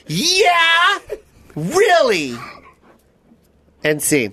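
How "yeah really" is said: mocking challenge. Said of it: "I'm gonna play you".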